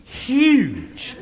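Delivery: imitating a scared little boy's cry, high and loud; child crying out. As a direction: cry it out like a terrified four-year-old. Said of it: "and I cried".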